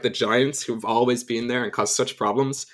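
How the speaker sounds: usual speaking voice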